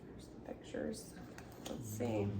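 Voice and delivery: barely voiced, near a whisper; hushed aside. Real, not acted. Quiet aside while reading.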